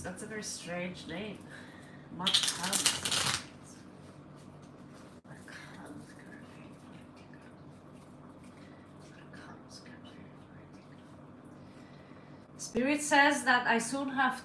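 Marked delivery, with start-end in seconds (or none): 5.3-11.1 hushed asking, almost no voice; whispered question. Real, not acted. Reader whispering her question to the tarot cards, whispered.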